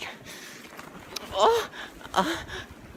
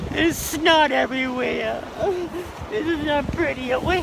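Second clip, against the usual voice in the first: snotty crying; 12 semitones higher, 7 dB louder, slower than usual. Acted. There's snot everywhere.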